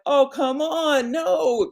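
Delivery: high, disbelieving protest; incredulous oh come on. Retold or imitated. Scoffing at the voice she hears, high incredulous protest. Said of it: "and I was like".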